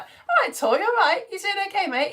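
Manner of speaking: high, over-bright hammed-up chirp; exaggerated fake cheeriness; mock cheery greeting. Acts out awkwardly cheery greeting.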